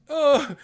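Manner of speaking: high, loud mock "oh"; blurted high, held "oh", imitating the actor. Retelling the slap scene, high loud mock cry. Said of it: "He's like".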